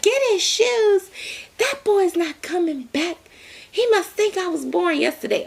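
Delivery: scene: Doctor T scoffing at the actor's excuse, high mock outrage; indignant director. Hammed-up high scoffing voice. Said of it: "And even Doctor T was like".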